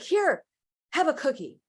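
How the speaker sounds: flustered mom. Retold or imitated. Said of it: "mom's like".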